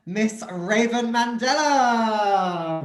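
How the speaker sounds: slow, drawn-out showman announcing; grand announcement. Acted. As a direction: announce her like a headliner. Host announcing the star guest.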